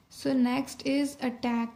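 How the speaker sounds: very slow, drawn-out dictation. Shown as a demonstration. Teacher dictating a word to shorthand students, very slowly. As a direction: read the next word for students to take down. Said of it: "teacher dictating next word".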